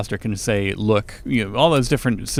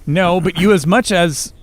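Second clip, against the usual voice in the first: heated, higher-pitched objection; lightly acted indignation; hotly; 7 semitones higher, 4 dB louder than usual. A narrator's reading. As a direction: begin a hot protest. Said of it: "she began hotly".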